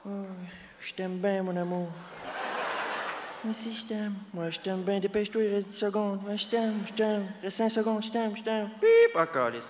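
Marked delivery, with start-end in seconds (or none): 0.1-8.7 lightly raised, soft lovey-dovey voice; mock tender reality-show lover, a bit higher; imitating a cooing Star Academy contestant; Wilfred impression. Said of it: "mon imitation de Wilfred dans Star Academy". 8.8-9.2 sharp high loud beep; imitated buzzer sound. Mock timer buzzer.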